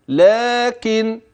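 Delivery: لكن chanted slowly with a long لا; natural madd. Shown as a demonstration. Teacher showing the natural madd in لكن, drawn out. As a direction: lengthen the alif after ل in لكن. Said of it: "إذن هذا مد طبيعي".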